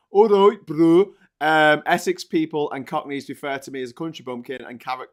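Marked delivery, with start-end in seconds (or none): 0.1-1.1 put-on loud, higher greeting in a Suffolk accent. Host greeting viewers as Suffolk accent man, broad Suffolk accent. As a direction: play up a country Suffolk accent, loudly. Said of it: "Mister Suffolk accent man here".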